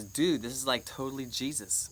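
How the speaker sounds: normal talking voice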